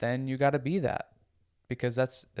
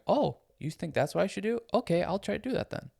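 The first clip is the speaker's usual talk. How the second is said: lightly voiced eager child, higher pitch; agreeable kid; 8 semitones higher than usual. Child readily accepting an adult's advice, agreeable. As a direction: go up high like a compliant kid. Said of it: "They're just going".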